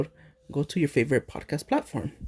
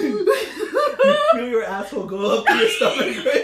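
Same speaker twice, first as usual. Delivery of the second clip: laughing; 7 semitones higher, 4 dB louder, slower than usual. Real, not acted. Co-host laughing.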